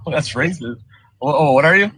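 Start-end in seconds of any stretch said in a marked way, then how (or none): none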